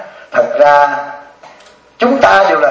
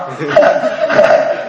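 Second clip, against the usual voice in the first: laugh; 2 semitones lower than usual. Real, not acted. Laughing after snoring joke.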